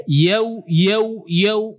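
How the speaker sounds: "Yau" closing completely on a rounded w; full waw ending. Shown as a demonstration. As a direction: finish each "Yau" on a clear oo sound, not o. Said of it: "Dan dia sempurna waw".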